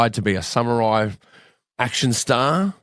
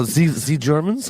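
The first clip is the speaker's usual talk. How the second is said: German accent; 2 semitones higher, slower than usual. Shown as a demonstration. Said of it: "Can you do any, uh, German accents?".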